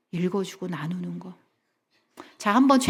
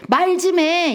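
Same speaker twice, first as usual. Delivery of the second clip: sharp, high, loud nag; wife nagging her silent husband to talk; demanding wife; 9 semitones higher, 6 dB louder, much slower than usual. Retold or imitated. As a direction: snap it out like a fed-up wife. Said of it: "이게 아니라".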